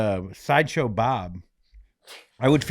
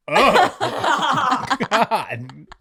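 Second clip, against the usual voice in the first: laughing; 10 semitones higher, 4 dB louder than usual. Retold or imitated. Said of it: "people are like".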